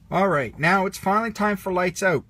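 loud call to the campers. A narrator's reading. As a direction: call lights out across the camp. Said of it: "called Sarge".

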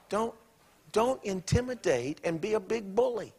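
ordinary delivery

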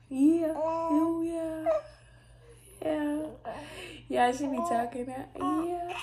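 high cooing yeahs. Real, not acted. Cooing back at the babbling baby.

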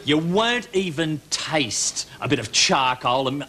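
ordinary delivery

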